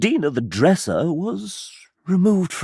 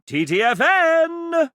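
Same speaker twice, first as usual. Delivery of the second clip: cheery sing-song farewell; 13 semitones higher, slower than usual. Acted. Mascot's cheery goodbye.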